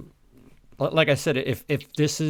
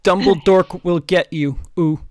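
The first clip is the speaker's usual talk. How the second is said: much louder and higher taunt; loud mock threat; 6 semitones higher, 10 dB louder than usual. Co-host voicing Draco taunting the villain, loud. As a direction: bellow it like a spiteful schoolboy.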